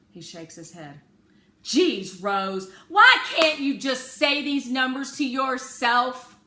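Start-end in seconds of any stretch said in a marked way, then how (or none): 1.6-6.4 exasperated father. A narrator's reading. Father scolds daughter.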